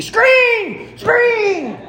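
very high, drawn-out mock shout; imitated high-pitched cheer-on shout; dad shouting at the TV. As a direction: yell it at the TV like an excited football fan. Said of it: "my dad would shout at the T V".

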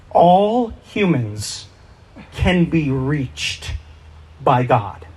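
slow, deliberate, weighted words; slow emphasis. Acted. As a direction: lay each word down slowly. Restates key truth slowly.

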